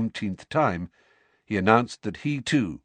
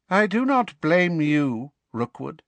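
high, slow, icy menace; cold, cruel voice; 7 semitones higher, 4 dB louder than usual. A narrator's reading. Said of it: "in that cold, cruel voice".

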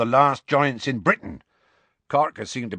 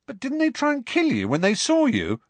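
much higher, disbelieving question; can't believe what he's hearing; incredulously. A narrator's reading. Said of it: "asked Ron incredulously".

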